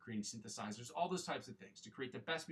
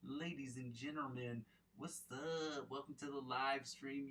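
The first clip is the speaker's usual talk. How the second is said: announcer intro; 4 semitones higher, slower than usual. Acted. Livestream host opening greeting.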